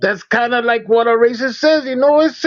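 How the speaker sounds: high-pitched, hammed-up Chicano accent; mock Mexican accent. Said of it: "live stream in that accent".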